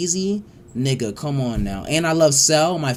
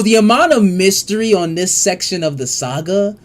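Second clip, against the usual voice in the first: usual speaking voice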